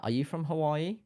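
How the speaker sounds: ordinary talk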